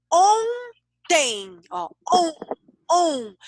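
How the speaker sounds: "Ontem" split into a long high ON and TEM; teacher sounding out "on" with drawn-out emphasis; syllable sound demo. Shown as a demonstration. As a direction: hold the nasal ON, then repeat it alone. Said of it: "que vai dar esse sonzinho".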